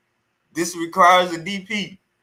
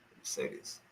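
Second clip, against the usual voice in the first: "Let me say this" whispered after the takes fade down; 18 dB quieter than usual. Shown as a demonstration. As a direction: drop to a breathy whisper on "Let me say this".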